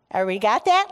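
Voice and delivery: ordinary delivery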